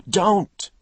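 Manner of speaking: a high, loud shriek of terror; woman screaming at her attacker; terrified scream. Acted. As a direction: scream in panic.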